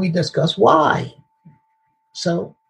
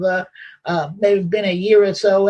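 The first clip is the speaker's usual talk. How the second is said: usual speaking voice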